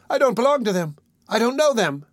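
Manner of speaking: put-on high-pitched boy's protest; desperate boy crying out. A narrator's reading. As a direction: protest high while being held. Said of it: "cried Oliver".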